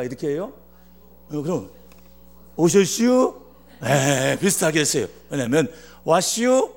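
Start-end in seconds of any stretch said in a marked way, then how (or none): 2.6-3.3 higher-pitched Chungcheong dialect greeting; rising Chungcheong-dialect lilt, much higher. Retold or imitated. Barber greeting a customer, in Chungcheong dialect. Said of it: "그 충청도 말로 인사를 했겠지요". 6.0-6.7 high, rising Chungcheong-dialect greeting. Shown as a demonstration. Barber greeting a customer, in Chungcheong dialect. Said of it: "충청도 말로".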